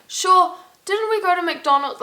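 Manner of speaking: high-pitched mock local chatter; lightly acted, high quoted question; Irish chat imitation. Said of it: "they always start with sure".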